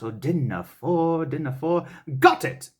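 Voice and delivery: searching for the word, then a sudden high "got it"; thinking it over. Acted. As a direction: mull it over, then pounce. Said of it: "one of those thought moments".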